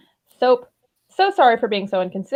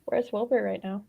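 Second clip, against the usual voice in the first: quiet question, much softer; Tubbo; 9 dB quieter than usual. A narrator's reading. Tubbo asking Tommy about Wilbur, quietly. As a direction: ask it softly, keeping your voice down. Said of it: "Tubbo asked quietly".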